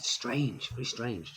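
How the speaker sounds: hushed, puzzled inner remark; thought to self. Retold or imitated. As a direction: murmur it privately, as a passing thought. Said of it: "I thought".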